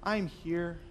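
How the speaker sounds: whisper-close comfort to someone sobbing; Holy Spirit comforting. Acted. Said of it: "Spirit says".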